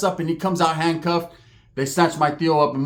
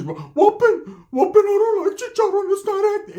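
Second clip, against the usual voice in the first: Ricky voiced much higher, a squeaky mock voice; high put-on voice; 14 semitones higher than usual. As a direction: squeak it out as the cousin.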